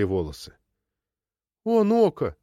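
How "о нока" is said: high-pitched, sorry exclamation; apologetic. A narrator's reading. Granddaughter apologising to grandmother, apologetic. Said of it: "извиняющимся тоном произнесла Шарло".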